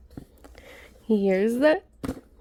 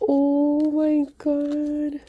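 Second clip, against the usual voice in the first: slow, high, amazed; drawn-out gasp of wonder; awed oh my God; 5 semitones higher, much slower than usual. Real, not acted. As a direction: awe at the card.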